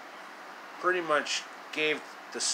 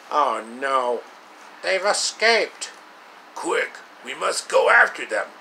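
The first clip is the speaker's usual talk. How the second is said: alarmed villain; 6 dB louder than usual. Acted. Villain discovers the escape.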